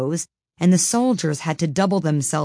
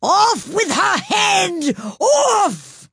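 very high, drawn-out furious scream; screamed command; 5 dB louder, much slower than usual. A narrator's reading. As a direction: scream the order at full force, stretching each word.

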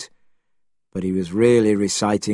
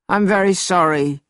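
higher-pitched, contrite plea; earnest apology; 8 semitones higher than usual. A narrator's reading. Monkey apologises after the pain.

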